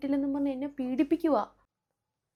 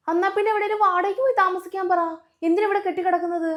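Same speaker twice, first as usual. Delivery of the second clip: sharp demand; 6 semitones higher, 5 dB louder, faster than usual. Acted. Daughter demands in-laws move out.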